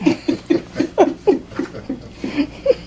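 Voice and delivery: a light, airy giggle up high; high breathy laugh. Real, not acted. Reader giggling after the child's protest, a high breathy laugh.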